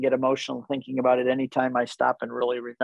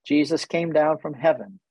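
ordinary talk

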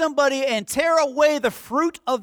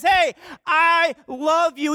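tender; 4 semitones higher, slower than usual. Acted. Jesus speaks to the sinner.

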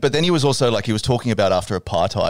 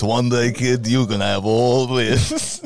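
slow, drawn-out mock mentor; lightly voiced old-guru figure; mock grand mentor; much slower than usual. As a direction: hand down the kingdom to a kid, hammed up. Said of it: "I just love Beaker Best".

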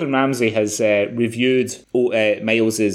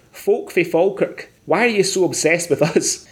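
put-on read in Scottish accent; 6 semitones higher than usual.

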